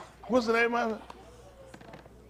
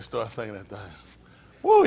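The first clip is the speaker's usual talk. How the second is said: ordinary delivery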